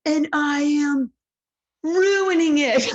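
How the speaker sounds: hammed-up despair, slow and loud; mock despair. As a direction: wail it like the world is ending, half joking. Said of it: "you're like".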